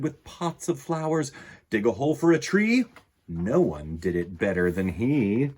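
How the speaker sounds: animated read-aloud with lively swings in pitch; children's story narration. A narrator's reading. Reading a picture book about a lion gardener to children, animated storytelling. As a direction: read it to children with playful, varied emphasis.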